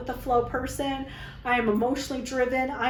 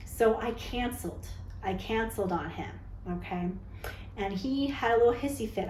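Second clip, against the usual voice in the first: ordinary talk, nothing marked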